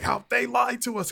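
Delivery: sudden high, indignant whine; mock outrage. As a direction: ham up a betrayed gamer. Mock outraged gamer reaction.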